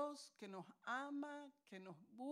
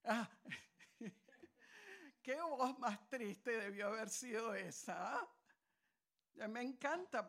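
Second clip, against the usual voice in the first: ordinary delivery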